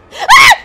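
sudden high scream of fright; real startled shriek. Real, not acted. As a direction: shriek at the jumpscare. Jumpscare by a monster.

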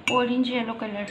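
ordinary delivery

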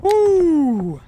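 high, piercing celebratory woo; excited whoop. Real, not acted. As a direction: whoop with joy. Celebrating in game.

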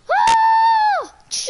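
very high, loud whoop; charging yell. Pretend. Player charging into a fight, high shouted whoop.

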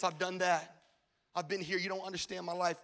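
ordinary talk

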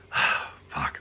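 a frustrated curse, breathed out softly and unvoiced; whispered curse. Real, not acted. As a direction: curse under your breath, fed up. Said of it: "I'm so pissed off right now".

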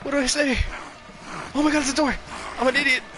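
shrieking in real fright; panicking gamer. Real, not acted. Chased by enemies in horror game.

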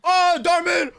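loud, high, over-the-top reaction shouts; mock outraged yelling. Reacting to Darman for chat, loud mock shouting. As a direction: bellow it like a hammed-up reactor.

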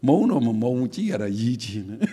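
lightly voiced coy little put-on; playful imitation of a preening speaker; mock coy swaying. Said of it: "ဟန်လေးလှုပ်ပြီးဒီလိုလေးဆို".

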